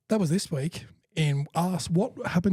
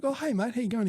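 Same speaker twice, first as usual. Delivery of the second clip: mock cheery greeting; 8 semitones higher than usual. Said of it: "And I'm like".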